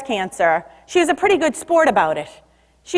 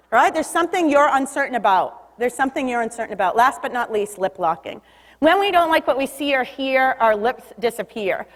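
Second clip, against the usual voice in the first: ordinary talk, nothing marked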